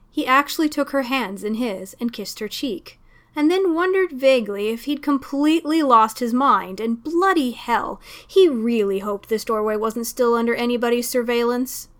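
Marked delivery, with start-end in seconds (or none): none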